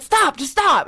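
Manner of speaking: lightly acted freak-out; Anthony freaking out. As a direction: retell a panicked plea to stop. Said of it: "he's freaking out, having a panic attack".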